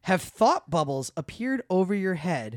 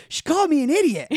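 quoting friend; 11 semitones higher, 7 dB louder than usual. Retold or imitated. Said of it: "She goes".

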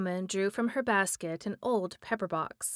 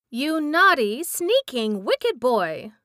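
loud, high angry outburst; furious scolding; 4 semitones higher, 7 dB louder, slower than usual. Acted. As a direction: tear into the boy. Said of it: "she exclaimed furiously".

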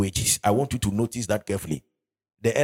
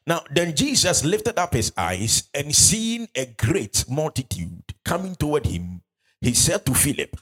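slow, raised scripture reading; 4 semitones higher than usual. Acted. Preacher reading John to congregation, slow and raised. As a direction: read the verse out with weight.

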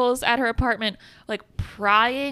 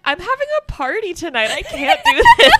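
high, loud squeal breaking into laughter; mock frantic Jennifer; 11 semitones higher, 8 dB louder than usual. Jennifer squealing she's too busy for this, laughing. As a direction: shriek it like a hostess losing it.

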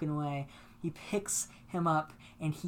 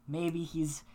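ordinary talk

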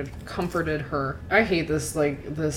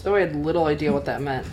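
ordinary delivery

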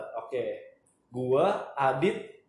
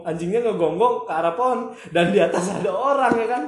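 normal talking voice

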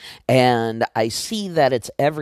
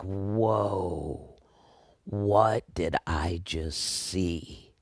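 awed hush; 3 semitones lower, 6 dB quieter, much slower than usual. Retold or imitated. Awed after vision.

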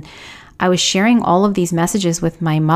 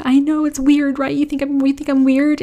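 much higher, nervous questioning; anxious high voice; 9 semitones higher than usual. Retold or imitated. Asking her husband if he thinks she's weird, in a much higher nervous voice. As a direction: pitch it way up, unsure of herself.

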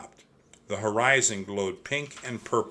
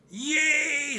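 high, bright cheer of joy; excited cheer; 3 dB louder than usual. A narrator's reading. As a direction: squeal with delight. Annie cheers story found.

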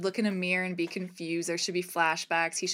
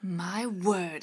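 ordinary delivery

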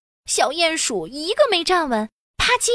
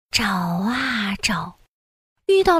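whispering; 5 semitones lower, slower than usual. A narrator's reading. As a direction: hush it as you search. Whispered search, hands over mouths.

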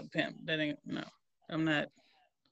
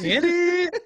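laughing hype; 9 semitones higher, 5 dB louder than usual. Real, not acted. Hyping friend up, laughing.

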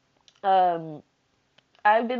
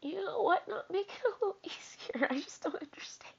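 high, hushed, nearly voiceless lament; tearful; 11 semitones higher, 8 dB quieter than usual. Real, not acted. Asking why life is not easier, tearfully whispering.

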